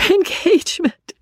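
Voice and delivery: very high, pained exclamation; distressed cry. Acted. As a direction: cry the word out in anguish. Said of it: "cried Marianne".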